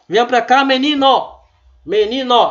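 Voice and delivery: put-on loud, high scolding call at a child; irritated mother shouting for her boy; scolding parent. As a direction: yell like a cross parent calling a kid in. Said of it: "quando o pai ou a mãe tá retado".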